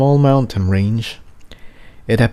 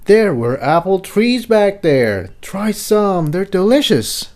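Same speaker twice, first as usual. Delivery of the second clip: raised, friendly pony voice; Jigsaw's voice; 9 semitones higher than usual. Acted. Jigsaw offering Tiptoe apples, high friendly voice.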